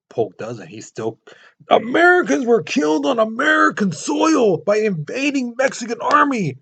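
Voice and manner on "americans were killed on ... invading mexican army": high, loud indignant shout; outraged yelling. Retold or imitated. Polk ignoring the senator, repeating a furious shout. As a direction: shout the line like a furious president. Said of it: "He's yelling".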